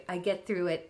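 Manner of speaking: usual speaking voice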